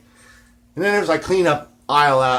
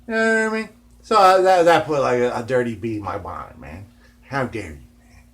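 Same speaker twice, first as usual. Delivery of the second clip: ordinary talk, nothing marked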